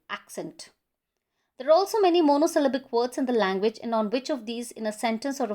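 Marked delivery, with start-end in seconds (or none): 0.1-0.7 "accent" stressed on AC, second syllable weak; quiet, falling "accent" with the weight on its first syllable. Shown as a demonstration. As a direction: say "accent" softly as AC-cent, first syllable stronger. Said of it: "the stress falls on the first syllable".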